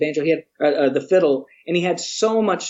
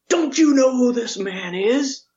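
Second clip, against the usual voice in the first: high, slow, hammed-up outrage on his behalf; a slow, high mock huff; mock indignant; 9 semitones higher, slower than usual. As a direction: play the offended big shot, slowly, half laughing. Byron indignantly vouching for narrator.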